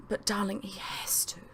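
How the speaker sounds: soft, whispered sighing plea; sighed plea. Acted. Mother sighing to her husband, soft whispered plea. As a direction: sigh it gently to him. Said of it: "my mother sighed".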